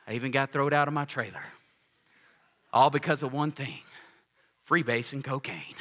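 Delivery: lightly acted solemn testimony, low and hushed toward the end; imitated testimony speaker. Former addict testifying to a room of kids, mock-solemn.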